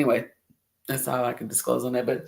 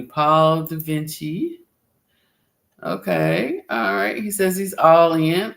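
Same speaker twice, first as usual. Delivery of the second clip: normal talking voice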